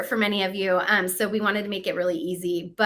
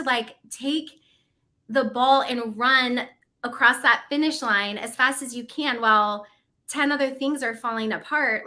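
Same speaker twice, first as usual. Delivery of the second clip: ordinary delivery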